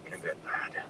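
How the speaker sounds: tired unvoiced whisper; calm him in a whisper. A narrator's reading. Weak father, hushed.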